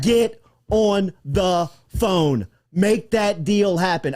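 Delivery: slow, heavy, every word pressed; slow insistent plea. Real, not acted. Radio host urging the athletic director to make the deal, slow and emphatic. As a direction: spell it out slowly so the athletic director hears. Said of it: "Scott Woodward, if you're listening".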